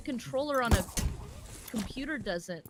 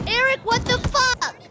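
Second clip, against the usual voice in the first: loud, high, drawn-out protest yell; outraged shout; 10 semitones higher, 8 dB louder, slower than usual. Real, not acted. Startled outcry at teammate mid-game.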